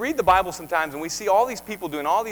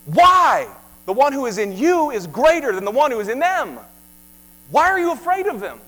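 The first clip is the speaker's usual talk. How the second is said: sharp, much higher, loud challenge; fiery preaching; 6 semitones higher, 8 dB louder than usual. Acted. Preacher confronting Christians afraid of isis, loud and high. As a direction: demand an answer from them.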